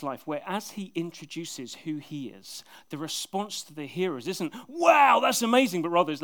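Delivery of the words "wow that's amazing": high, loud amazed exclamation; mock wonder from the crowd; impressed wow. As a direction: gasp out a big wow of amazement. Imagined impressed reaction to Jesus.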